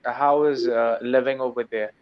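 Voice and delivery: usual speaking voice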